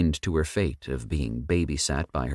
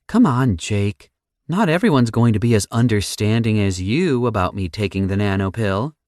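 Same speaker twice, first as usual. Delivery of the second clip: Sam voice; 5 semitones higher, 5 dB louder than usual. A narrator's reading.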